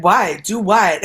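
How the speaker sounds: loud, high, playing dumb; mock confusion. Retelling to friends, loud mock what. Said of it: "And I was like".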